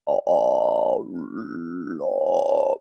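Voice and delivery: very low, fat fried growl; deep rasping growl. Shown as a demonstration. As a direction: rumble it in deep vocal fry. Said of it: "it is so fat and so low".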